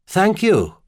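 courteous tenor reply; pleasant tenor. A narrator's reading. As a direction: give thanks in a light tenor. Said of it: "he replied in a pleasant tenor voice".

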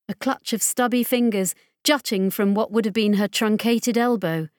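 ordinary delivery, nothing marked

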